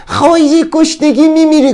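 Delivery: high-pitched, far-off-from-self warning voice; scolding doom-monger. Acted. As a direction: put on a high voice to threaten disaster. Inner voice threatens starvation.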